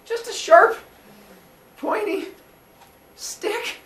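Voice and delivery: squeaky high innocent voice; fake innocent. Cronus feigns innocence to his father, high and squeaky.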